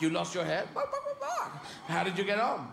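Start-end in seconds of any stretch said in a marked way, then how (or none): 0.7-1.5 high barked words like a small dog; yappy dog barking. Pretend. Barking like a dog to answer the man. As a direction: bark back like a dog.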